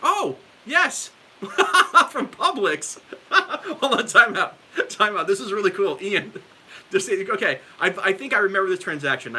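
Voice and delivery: high, loud, laughing and thrilled; can't hold back the laugh of recognition; laughing with delight. Real, not acted. Streamer recognizes the grocery clerk in chat, laughing with delight.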